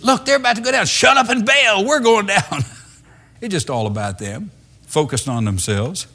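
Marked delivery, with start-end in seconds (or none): 0.0-2.4 high, loud mock shout of panic; panicked shout. Preacher acting panicked sailors yelling at each other, loud and high. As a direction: yell at the crew to bail. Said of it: "They said".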